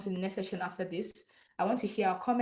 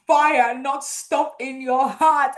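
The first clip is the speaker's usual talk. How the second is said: laughing; 4 semitones higher, 10 dB louder, much slower than usual. Real, not acted. Laughs mid sentence.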